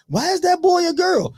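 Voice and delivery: put-on high, curious child voice; lightly voiced little girl asking; imitating little daughter. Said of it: "My daughter looking at me".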